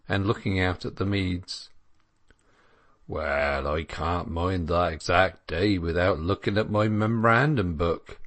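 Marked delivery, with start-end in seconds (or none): 3.1-8.2 rustic dairyman, wholly untroubled; unconcerned dairyman. Acted. Dairyman answering the anxious milkmaids, unconcerned country voice. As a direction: answer as a countryman who sees nothing at stake. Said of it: "replied Crick with the same intolerable unconcern".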